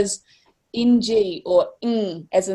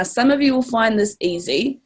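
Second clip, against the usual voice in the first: ordinary delivery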